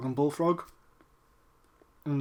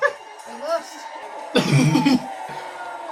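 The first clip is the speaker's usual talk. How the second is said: high giggling fit; excited high laugh; giddy laughter; 6 semitones higher than usual. Real, not acted. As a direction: laugh breathlessly with glee. Elated while watching match.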